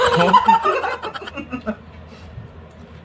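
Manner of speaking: breathy high laugh. Real, not acted. Laughs after chest-tightness joke.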